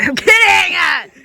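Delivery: high, loud joking yell; joking shout. Real, not acted. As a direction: shout it, then laugh it off. Brother shouts a joke.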